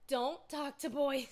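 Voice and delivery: put-on slow, loud, emphatic Don't talk to boys; mock stern rule. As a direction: read the planner note like a strict order. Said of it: "the biggest thing is, like".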